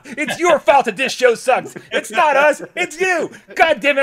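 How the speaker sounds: high, loud hammed-up tirade; mock angry rant. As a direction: shout the blame at the viewers, hamming it up. Mock rant blaming viewers.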